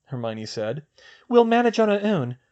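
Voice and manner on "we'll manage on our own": put-on higher young woman's voice, brisk; girl's voice. Hermione planning with Luna, in a higher girl's voice. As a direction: voice the girl, a clear step up in pitch. Female character voiced high.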